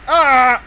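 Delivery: loud, high short shout; startled scream. Real, not acted. Player caught out in the game, startled scream. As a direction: scream in sudden alarm.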